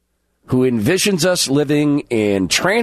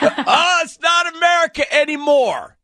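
mocking shout; 13 semitones higher than usual. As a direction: shout it in mock shock. Host mocks clip loudly.

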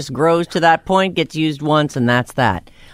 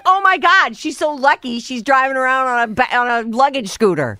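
gushing onlooker; 9 semitones higher than usual. Retold or imitated. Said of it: "They'd be like".

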